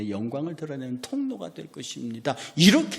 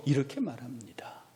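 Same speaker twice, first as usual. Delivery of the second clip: whisper; 7 dB quieter than usual. Real, not acted. Preacher drops after quoting.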